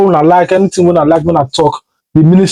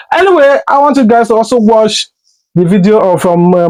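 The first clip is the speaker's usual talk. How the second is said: ordinary talk, nothing marked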